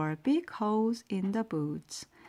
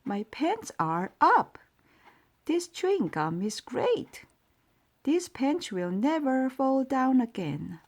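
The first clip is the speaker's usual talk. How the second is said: higher, proud and cheerful; delighted; 6 semitones higher than usual. A narrator's reading. Old mouse thrilled his pants stay up, delighted. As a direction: crow about the chewing gum. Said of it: "cried the old mouse".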